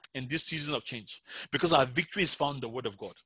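ordinary talk

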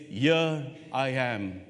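very slow, hushed reply; reverent answer. Acted. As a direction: answer slowly, in awe. Moses answering God's call.

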